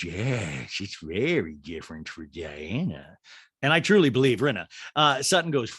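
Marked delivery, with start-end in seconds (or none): 0.0-3.2 low, slow drawl; slow, low celebrity send-up; Rinna. Retold or imitated. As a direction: drag it out in a dropped put-on voice. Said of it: "And Rena goes".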